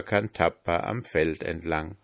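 usual speaking voice

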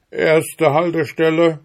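high, loud stop announcement; called out. A narrator's reading. Bear coachman announcing the stop to passengers, loud high call. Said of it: "schrie er".

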